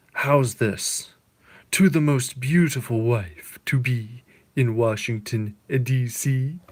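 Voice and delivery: lightly voiced charming husband, slow; a hint of a smooth husband toasting; voicing the senator. Husband toasting his wife at Christmas, lightly acted. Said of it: "And he says".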